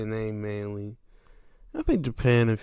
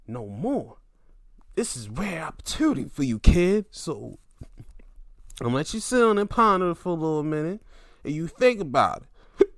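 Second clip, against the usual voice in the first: higher, louder pompous voice, other timbre; hammed-up arrogant boss; mock MJF; 7 semitones higher, 6 dB louder than usual. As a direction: ham up a smug condescending heel. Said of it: "M J F tries to be like".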